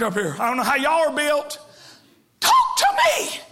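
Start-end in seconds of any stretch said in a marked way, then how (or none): 2.4-3.4 high mock plea to a silent spouse; pleading spouse. Preacher voicing a spouse begging the silent partner to speak. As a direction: beg like a spouse who gets no answer.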